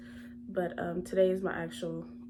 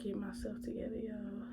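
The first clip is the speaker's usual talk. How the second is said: holding back tears; 8 dB quieter than usual. Real, not acted. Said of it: "And I'm trying not to cry".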